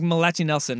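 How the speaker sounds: "Malachi" as muh-LATCH-ee; wife's mispronunciation. Retold or imitated. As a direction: confidently say "Malachi" with a "ch" and final "ee". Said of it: "the way she says".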